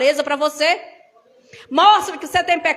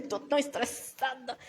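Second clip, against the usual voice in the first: much softer, worn-out sigh of stress; weary admission; 2 semitones lower, 13 dB quieter than usual. Retold or imitated. Tired woman telling a sister she is stressed, softly. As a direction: let it drop out tired and drained. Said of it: "Eu falei".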